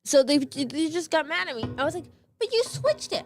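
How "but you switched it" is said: high, exasperated protest; indignant protest. Retold or imitated. To the teacher who blamed her, high indignant protest. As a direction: insist you were wronged, voice jumping high.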